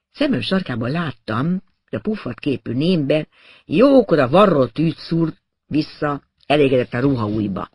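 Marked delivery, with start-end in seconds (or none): none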